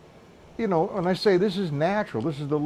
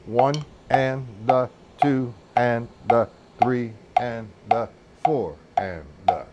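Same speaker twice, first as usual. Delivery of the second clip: slow, evenly spaced count in a lowered pitch; rhythmic counting; 4 semitones lower than usual. Shown as a demonstration. Drum teacher showing his way to count eighth note triplets, rhythmic counting. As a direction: chant each syllable on a steady beat. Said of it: "I count an eighth note triplet two ways".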